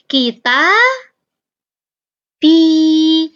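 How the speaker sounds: ordinary talk, nothing marked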